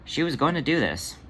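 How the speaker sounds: usual speaking voice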